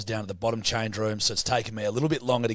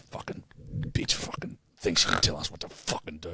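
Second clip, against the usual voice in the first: low angry whisper under the breath; grumbling about the woman from the ice bath, whispered; whispered muttering; 8 semitones lower, 3 dB quieter than usual. Retold or imitated. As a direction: seethe it quietly to yourself.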